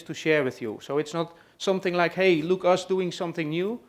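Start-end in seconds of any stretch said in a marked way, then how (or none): none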